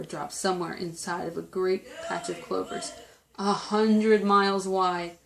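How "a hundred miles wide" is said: ordinary delivery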